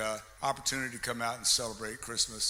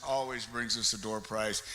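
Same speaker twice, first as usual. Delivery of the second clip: ordinary talk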